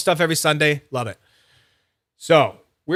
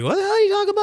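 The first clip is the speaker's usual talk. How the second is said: confused viewer; much faster than usual. Retold or imitated. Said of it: "If you're like".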